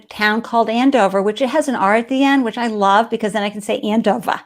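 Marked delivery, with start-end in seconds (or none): 3.8-4.4 Boston accent. Shown as a demonstration. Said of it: "with my Boston accent".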